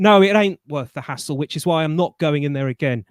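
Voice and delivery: ordinary delivery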